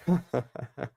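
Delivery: soft chuckle under breath; short quiet laugh. Real, not acted. Laughs at own joke.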